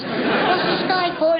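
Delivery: put-on high child's voice asking "What's this guy for?"; child's question. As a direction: pipe up like a curious little kid. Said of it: "asking questions like".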